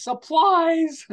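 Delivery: sudden high shouted punchline; high yelled "Supplies!"; scream. Retold or imitated. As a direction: burst out the word like a jump scare. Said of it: "he opens the door and screams".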